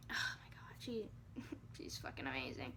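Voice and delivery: real hushed, near-whispered wonder; very soft and low, with a breath of laughter; overcome with awe. Real, not acted. Mother marvelling at her amazing baby, hushed awe.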